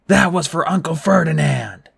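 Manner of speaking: Grover yelling back. A narrator's reading. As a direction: yell it back vengefully. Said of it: "Grover yelled back".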